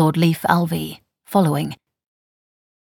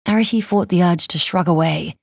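ordinary delivery

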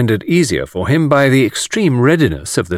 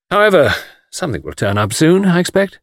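lifting into a hopeful, higher ending; 7 semitones higher than usual. A narrator's reading. Anthony assures his friend that work will come, hopefully. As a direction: let the hope rise at the end. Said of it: "he remarked hopefully".

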